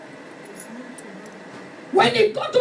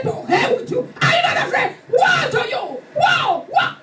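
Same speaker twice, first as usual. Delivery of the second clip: fierce rising shout; shouting a curse; 6 semitones higher than usual. Retold or imitated. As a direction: cry out woe at them like a war cry. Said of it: "I start to shout in my house".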